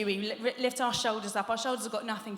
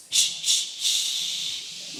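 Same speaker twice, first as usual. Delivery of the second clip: louder forceful hiss of air; loud shh; 5 dB louder, much slower than usual. Shown as a demonstration. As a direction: blast the air out from the belly. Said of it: "lift up the diaphragm".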